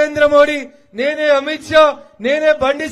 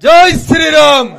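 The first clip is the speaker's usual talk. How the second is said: slogan shout; 9 dB louder, slower than usual. Real, not acted. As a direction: shout it to the whole ground. Religious slogan at rally.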